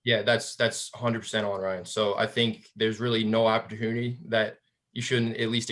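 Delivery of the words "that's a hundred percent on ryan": ordinary talk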